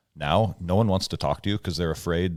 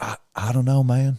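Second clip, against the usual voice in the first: lightly Southern shrug; 5 semitones higher than usual. Retold or imitated. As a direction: shrug it off in a slight Southern accent. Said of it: "he had the heavy Southern accent".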